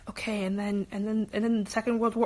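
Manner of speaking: soft, low mumble while writing, half to yourself; mumbling to yourself. Shown as a demonstration. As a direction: murmur it like you're thinking aloud over homework. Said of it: "you talk to yourself kinda like".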